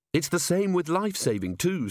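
high-pitched, keen explanation; earnest officer. Acted. Officer explains first aid to a colleague, earnest. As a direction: explain brightly, pitched well up.

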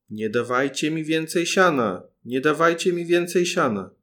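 loud, fearful shout from inside; frightened cry. A narrator's reading. Paluszek trapped in the cow's stomach cries out, frightened shout. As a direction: cry out in alarm.

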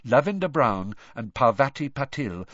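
usual speaking voice

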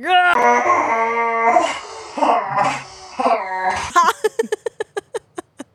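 loud, drawn-out mock angry roar. Host showing her all-in-red angry thumbnail look, mock roar. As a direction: let out a long growling roar in bursts. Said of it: "and I'm like".

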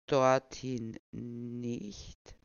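very slow and calm; unbothered old man. Pretend. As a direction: state it serenely. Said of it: "meinte Noah ruhig".

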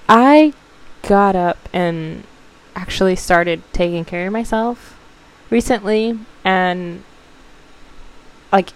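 normal talking voice

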